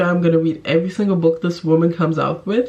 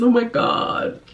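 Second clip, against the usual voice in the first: higher, giddy oh my god; delighted gush; 6 semitones higher, slower than usual. Real, not acted. Vlogger recalling how happy Book Lovers made her, giddy.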